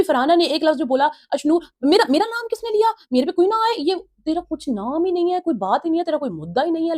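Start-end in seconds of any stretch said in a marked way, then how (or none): 1.3-7.0 high-pitched heated scolding; imitating Farhana lashing out at Ashnoor; angry outburst. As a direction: throw it at her, voice up high. Retold Farhana shouting at Ashnoor.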